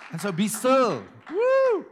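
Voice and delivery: loud, high cry of joy; rousing shout. Real, not acted. Preacher rousing his congregation, loud high excited shout. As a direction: lift the whole room.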